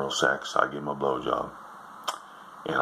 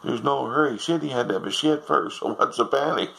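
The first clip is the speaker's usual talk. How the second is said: put-on little old lady; 11 semitones higher than usual. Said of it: "little lady said".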